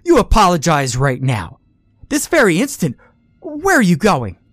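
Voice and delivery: a mother ordering her son back; furious command. Acted.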